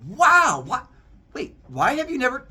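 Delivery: loud, high amazement; stunned outburst. Acted. Grandson to grandma after big news, acted amazement. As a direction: blurt it out in mock astonishment.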